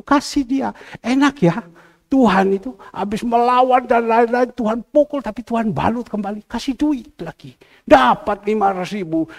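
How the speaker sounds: high, loud, incredulous-sounding preacher; exclaimed high-pitched sermon delivery; animated preaching. Acted. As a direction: build the testimony to an excited climax. Preaches excitedly at full force.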